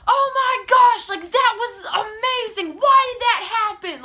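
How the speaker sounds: high, loud, gushing rant; excited ranting. Retold or imitated. Rushing downstairs to stepdad at 2am, gushing loudly. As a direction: burst out about the book's ending. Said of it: "I was like".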